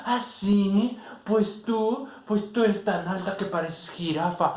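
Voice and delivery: put-on high, loud childish jab; taunting insult. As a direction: fling the insult like a playground taunt. Answering insult in quarrel. (burla infantil, aguda y fuerte)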